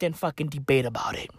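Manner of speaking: quick hushed whisper; gossiping under the breath; fast whisper. Real, not acted. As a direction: rattle it off in a whisper. Conspiratorial aside continues.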